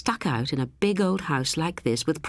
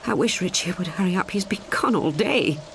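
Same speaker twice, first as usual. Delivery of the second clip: frightened whisper; 3 semitones higher than usual. A narrator's reading. Rosie shivering during the storm.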